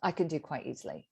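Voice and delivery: normal talking voice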